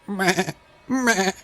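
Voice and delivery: high bleating goat cry. Acted. Narrator voicing the stung goat, high bleat.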